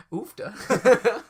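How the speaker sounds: Southern belle oofta. Pretend. Southern belle improv joke.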